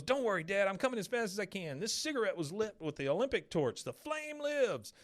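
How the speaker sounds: put-on high, excited boy's voice; rally everyone, stretch out the last words; Bobby, triumphant. Bobby calling to his dad while running with the flame. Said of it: "Bobby runs up and he goes".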